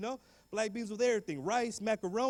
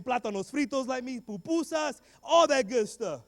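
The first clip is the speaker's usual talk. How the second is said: excited; 6 semitones higher, 7 dB louder, slower than usual. Real, not acted. Proud list of foods.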